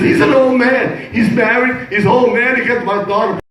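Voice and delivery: high, slowed indignation; a comic father fuming about the old man; mock outrage.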